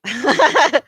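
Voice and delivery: high, loud burst of laughter. Real, not acted. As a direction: laugh it off loudly, pitched high. Laughs after own quote.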